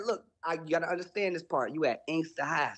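usual speaking voice

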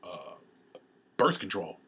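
ordinary talk, nothing marked

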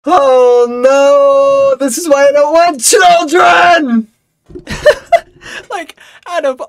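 high, loud hysterical yell; hysterical shout. Retold or imitated. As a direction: scream it out in disbelief. Hysterical reaction to chaos.

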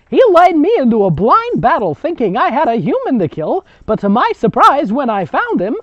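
put-on high, loud over-the-top old woman; grandmother storyteller. Grandmother telling the girls about the ghoul, high and loud. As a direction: belt out the grandma's tale with relish. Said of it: "That's the grandmother".

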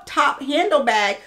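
usual speaking voice

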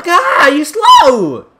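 high yell that cracks; squeaky cracked shout; voice cracking. Real, not acted. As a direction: voice breaks up high mid-yell. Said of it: "my throat cracked there".

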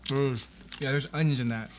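talking between chews; words slightly muffled by food; mouth full, chewing. Real, not acted. Seen 0: Woman bit burrito at -4s, cheeks still working while replying.

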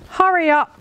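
high, urgent dog character; high-pitched bossy little bark; barked urgently. A narrator's reading. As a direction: hurry them along in a high, eager voice. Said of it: "barked Midge".

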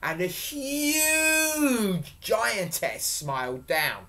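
loud, high, stretched-out words; dramatic storytelling. A narrator's reading. Storyteller introducing the huge giantess, dramatically. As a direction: stretch the key words for suspense.

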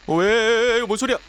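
high, loud alarmed cry; scared player hearing a noise; startled fright. Real, not acted. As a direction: jolt at a sudden noise. Hears strange noise in elevator.

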